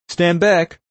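loud, high-pitched warning call. A narrator's reading. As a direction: call out a sharp order to clear the area. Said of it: "Warren warned us".

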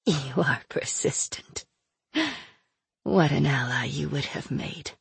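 weary but still mocking; soft villain voice. Aina weak after pain.

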